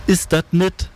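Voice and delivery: shrieking woman. A narrator's reading. Woman screeching at rotten plums, shrieking. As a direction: screech it in narrated dismay, high above the reading voice. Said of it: "kreischte sie".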